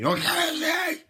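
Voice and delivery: sudden high, loud yell; excited shout. Pretend. Reacting to a KFC advert, high excited shout.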